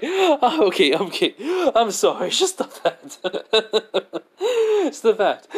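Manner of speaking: high-pitched, helpless laughter through the words; laughing at her. Acted. Boy laughing at the girl's threat, laughing. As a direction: crack up while trying to apologize. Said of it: "he just starts laughing at her".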